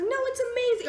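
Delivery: high, sweet aunt voice; high gushing imitation of the aunt; mock aunt gushing. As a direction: coo it like a doting aunt. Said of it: "My aunt's like".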